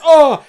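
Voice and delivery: high, loud "oh" of shock; mock shocked gasp. Acting out the film character's reaction, high shocked cry. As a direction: cry out as if badly startled. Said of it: "Joaquin's fa-, reaction in that movie".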